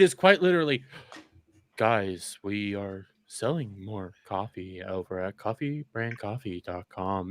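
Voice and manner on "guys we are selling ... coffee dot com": slow, low mock-pitchman drone; boring sales pitch. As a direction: imitate a tedious coffee plug, dragging it out. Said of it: "'Cause it's so boring".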